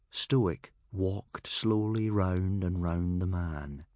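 usual speaking voice

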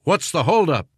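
high, impatient-style called question; calling out. A narrator's reading. Prankster posing as major questions captain, calling out. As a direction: call it up the line. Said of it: "a voice called out".

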